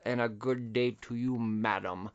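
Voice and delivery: Gangs of New York butcher. Retold or imitated. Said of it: "I picture him with the voice of, like, the butcher from Gangs of New York".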